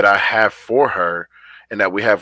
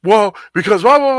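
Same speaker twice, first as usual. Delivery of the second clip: much higher and louder mock daughter chatter; imitating his daughter; 11 semitones higher, 8 dB louder than usual. Dad imitating his daughter venting, high and loud. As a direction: babble like the kid finally opening up. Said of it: "She'll start talking".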